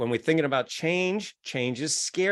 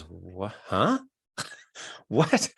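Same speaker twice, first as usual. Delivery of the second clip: confused brain; 7 semitones lower, much slower than usual. Acted. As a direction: sound caught off guard. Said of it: "your brain goes".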